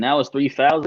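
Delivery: usual speaking voice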